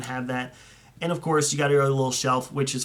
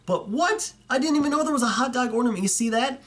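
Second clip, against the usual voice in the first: much higher and fast, wide-eyed; gushing to viewers about a find he can't believe; excited disbelief; 8 semitones higher than usual. Real, not acted. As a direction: rattle it off in amazed excitement. Disbelief at the ornament.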